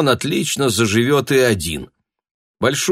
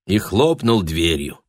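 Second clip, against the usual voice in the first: ordinary delivery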